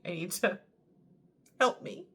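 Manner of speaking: ordinary delivery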